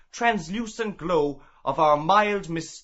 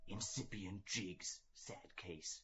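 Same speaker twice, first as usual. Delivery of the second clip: soft whispered aside; 10 semitones lower, 25 dB quieter than usual. Acted. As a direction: whisper it behind his back. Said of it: "he whispered to J J O'Molloy".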